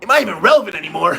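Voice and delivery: indignant, high and fast comeback; Travis Major. Acted. Travis answering a question about his relevance, indignant and high-pitched. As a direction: throw the question back in disbelief, high and quick. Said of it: "that was my Travis Major impersonation".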